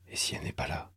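pensée inquiète chuchotée, plus grave; chuchoté. A narrator's reading. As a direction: souffler la crainte tout bas. Pensée angoissée de Franck. (murmure angoissé intérieur)

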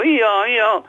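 high-pitched imitation of a braying donkey. A narrator's reading. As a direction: bray in words like a donkey. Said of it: "se mit à braire".

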